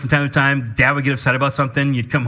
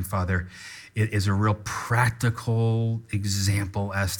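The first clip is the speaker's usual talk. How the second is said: soft, lower pastoral prayer voice; earnest prayer; 6 semitones lower, 5 dB quieter than usual. Acted. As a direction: pray sincerely on behalf of the congregation. Leads a prayer.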